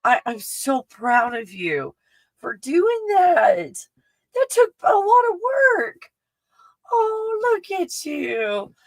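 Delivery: high, slow squeal of pride; drawn-out, high-pitched cooing praise; proud gushing. Real, not acted. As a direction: stretch the words out in high delight. Gushing over friend's big weight loss.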